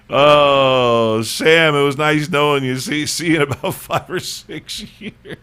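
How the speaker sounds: high, loud sing-out with a laugh; put-on jovial send-off, breaking into laughter; mock farewell. Someone greets doomed Sam in Texas, hammed-up high farewell.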